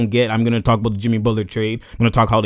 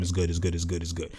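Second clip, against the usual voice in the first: much softer, low, dismissive repetition; brushing it off; 5 semitones lower, 9 dB quieter than usual. Retold or imitated. Clay walking off, softly telling himself it's fine. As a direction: wave it away under your breath.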